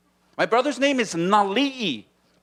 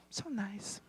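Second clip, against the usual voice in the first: whispered, breathless; soft whispered sympathy; 2 semitones higher, 19 dB quieter than usual. Acted. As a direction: hush it like at a wake. Mourner whispering.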